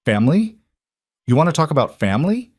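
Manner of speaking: fast, rising retort, snapping back; mother finally snaps at her son. Acted. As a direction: throw the word back at him. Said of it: "Something in me snapped".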